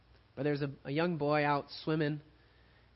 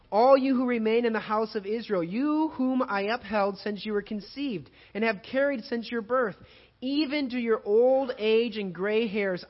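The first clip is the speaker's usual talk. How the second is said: louder, higher reading of scripture, slowing on key lines; 5 semitones higher, 4 dB louder than usual. Acted. Pastor reading Isaiah to the congregation, proclaiming loudly. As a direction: proclaim God's words to the congregation, slow and strong.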